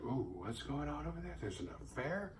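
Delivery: soft, scandalised sitcom gasp; quiet, juicy sitcom snooping; sitcom gossip. Pretend. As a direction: hush it like a Three's Company busybody. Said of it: "Three's Company shit where she's like".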